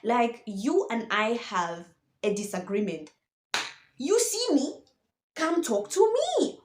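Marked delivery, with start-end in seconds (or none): 4.0-6.6 lifted high, pleading call to a teammate; high, appealing come-talk-to-me; mock pleading. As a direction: say it up high like you're begging him to just talk. Mock direct address to Sancho.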